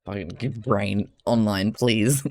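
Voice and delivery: normal talking voice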